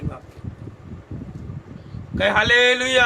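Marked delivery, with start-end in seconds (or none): 2.2-3.1 rallying call. Real, not acted. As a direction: call out to the congregation for an answer. Preacher calls for congregation's response. (ज़ोर से hallelujah का आह्वान)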